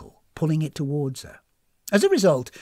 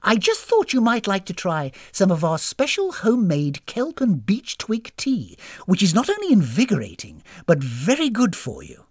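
higher, louder sing-along sales lilt; eager, beaming hostess; 5 semitones higher, 5 dB louder than usual. Acted. Hostess offering guests homemade tea, beaming. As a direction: offer it with hospitable enthusiasm.

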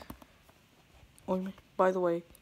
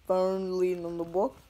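says "box" where "bus" was meant; "box" said by accident instead of "bus"; slip of the tongue. Real, not acted. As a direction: trip on the word "box" by mistake. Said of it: "I almost said box there".

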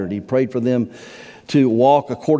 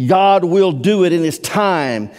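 raised, loud, slow pulpit delivery; high, emphatic preaching; preached line; 7 semitones higher, 5 dB louder, slower than usual. Acted. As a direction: proclaim it slowly from the pulpit. Preached take, later repeated plainly.

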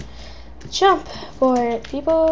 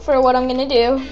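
ordinary talk

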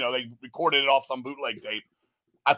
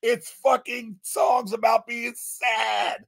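ranting shout; 6 semitones higher than usual. Real, not acted. Ranting at the mopey songs.

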